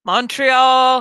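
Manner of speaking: loud called-out city name; shout. Acted. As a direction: blurt it out loud.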